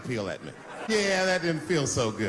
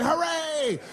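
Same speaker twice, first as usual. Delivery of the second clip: mock crowd cheer; 12 semitones higher than usual.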